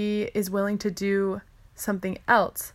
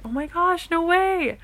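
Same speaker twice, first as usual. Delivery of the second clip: high and louder, amazed, replaying her own reaction; retold excited gasp; 10 semitones higher, 4 dB louder than usual. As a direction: gush like you just saw the photo.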